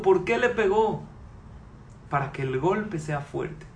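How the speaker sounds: normal talking voice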